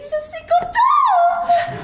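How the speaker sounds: very high, drawn-out cry of joy; delighted squeal. Acted. Woman greets her lover at the hotel, overjoyed squeal.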